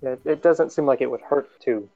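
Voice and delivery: usual speaking voice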